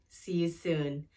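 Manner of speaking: plain reading voice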